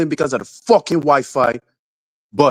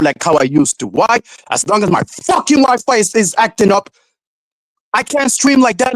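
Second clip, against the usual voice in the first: raised, heated complaining; pissed off rant; 6 semitones higher than usual. Real, not acted. Streamer venting to chat about his Wi-Fi, heated rant. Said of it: "I'm just pissed the fuck off".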